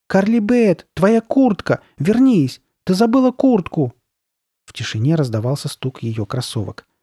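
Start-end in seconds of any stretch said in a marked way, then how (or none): 0.1-3.9 high, loud urgent calls; shouting after someone. A narrator's reading. Sabrina to running friend, shouting after her. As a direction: shout it down the street, pitched up. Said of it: "раздавались крики Сабрины".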